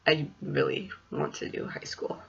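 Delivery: quiet whispered aside to herself; whispering. Real, not acted. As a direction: drop to a breathy whisper.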